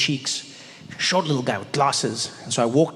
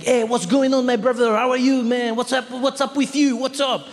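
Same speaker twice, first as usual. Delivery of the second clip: hyped clerk; 8 semitones higher than usual. Retold or imitated. Young Paris clerk to the comedian, high excited greeting.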